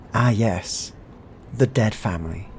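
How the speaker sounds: ordinary talk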